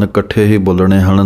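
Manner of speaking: ordinary talk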